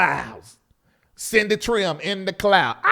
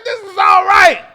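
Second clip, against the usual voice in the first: high, loud exclamation; delighted shout; 11 semitones higher, 9 dB louder than usual. Retold or imitated. Host approving of the storefront church, high happy shout. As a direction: holler your approval over the praise. Overcome reaction to the praise service.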